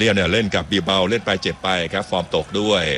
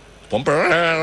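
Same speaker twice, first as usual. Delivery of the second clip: long drawn-out, higher-pitched plea of innocence; Neto's mock denial; 8 semitones higher, much slower than usual. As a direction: stretch it out slowly like a player protesting to the referee. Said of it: "เนโต้บอก".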